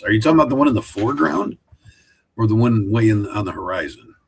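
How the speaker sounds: ordinary talk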